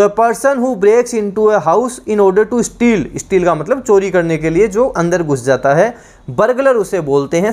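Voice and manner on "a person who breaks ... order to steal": very slow, each English word spaced for note-taking; slow dictation. Shown as a demonstration. Teacher dictating to students, very slow and spaced. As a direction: read the definition out word by word, slowly.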